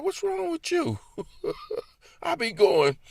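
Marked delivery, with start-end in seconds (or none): 0.0-1.0 very high quizzical wife voice; wife's question. Retold or imitated. Wife asking her husband, high and puzzled.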